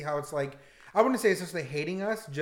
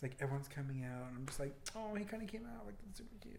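whispering; 3 semitones lower, 19 dB quieter than usual. Retold or imitated. Gushing about coming out videos.

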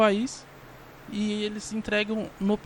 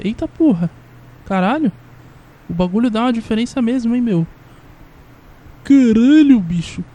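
excited; 3 semitones higher, 7 dB louder than usual. Real, not acted. Amazed by new sound board.

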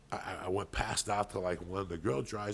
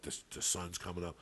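ordinary talk

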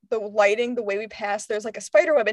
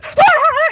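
high, loud mock scream; imitated scream; 10 dB louder than usual. Friends jumping at someone in the hallway, imitated scream. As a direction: re-enact a startled group scream. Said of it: "just jumps and screams in the hallway".

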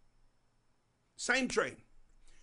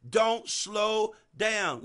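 each word drawn out slow; slow, deliberate preaching line; slowed right down; much slower than usual. Shown as a demonstration. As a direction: stretch it out, x0.5 pace. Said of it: "Let me slow down".

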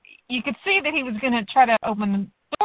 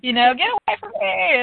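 higher, louder acted alarm; mock fearful outburst; 8 semitones higher, 6 dB louder than usual. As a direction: act out wanting everyone to keep away. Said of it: "definitely on the fearful side".

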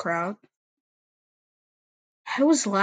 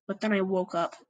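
plain reading voice

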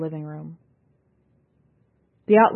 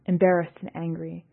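usual speaking voice

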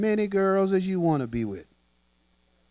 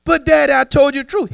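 higher, whiny protest; pleading child; 9 semitones higher, 7 dB louder than usual. Retold or imitated. Boy pleading with his dad to skip the spanking, high and whiny. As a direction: beg daddy off the whipping.

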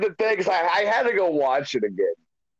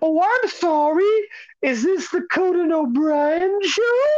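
much higher, mock-apologetic put-on voice; sarcastic put-on voice; 14 semitones higher than usual. Retelling Hunter needling Conan on his show, high mock-apologetic voice. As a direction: ham up a fake sorry in a high voice. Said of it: "then he goes".

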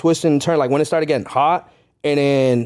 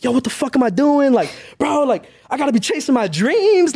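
retold scream to himself; 9 semitones higher than usual. Podcaster retelling a rough day at the bank, mock screaming. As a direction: scold yourself in a high, exasperated retold yell. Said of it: "I'm just screaming to myself".

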